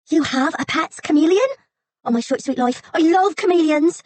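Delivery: high, loud, slow gush of joy; joyful squeal. Acted. Assistant overjoyed to hear about a pet chameleon, loud. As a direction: swoon over chameleons. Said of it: "clutched her cheeks in joy".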